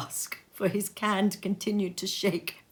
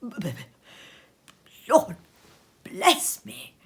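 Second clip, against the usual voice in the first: breathless gasp. A narrator's reading. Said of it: "cried the gentleman, as if his breath were taken away".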